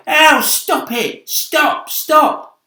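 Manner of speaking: loud high yelp of pain; ow, hurt and shouting; pained cry. Acted. Centipede hit on the nose by hail, loud pained cry.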